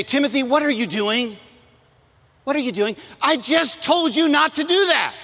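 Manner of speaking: loud, high scolding shout at her son, slowing as it builds; a furious mom blowing up at her kid; mother yelling. Retold or imitated. Mother scolding young Timothy, loud high angry shout. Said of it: "it was kind of that loud".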